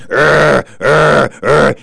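loud barking imitation of a dog. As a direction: bark out like an angry dog, loud. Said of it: "and the dog's going".

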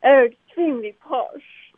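exaggerated posh English accent. Shown as a demonstration. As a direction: draw it out in mock-posh English. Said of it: "You can be very posh".

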